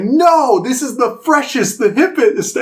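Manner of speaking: high, emphatic protest; imitating indignant boasting. Passenger defending his hat to the driver, indignant. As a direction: insist in a high, offended voice.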